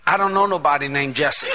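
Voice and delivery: low-pitched retold denial; father's denial. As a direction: mimic a dad denying it, pitched well down.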